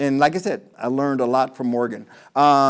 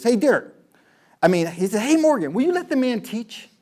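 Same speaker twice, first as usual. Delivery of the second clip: higher-pitched agent butting in; 7 semitones higher than usual. Retold or imitated. Agent cuts into the argument.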